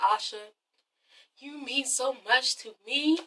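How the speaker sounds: very soft, slow sincerity; acted hushed, unhurried love speech; tender declaration. Derek proposing on one knee, soft and tender.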